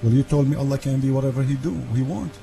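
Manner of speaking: ordinary talk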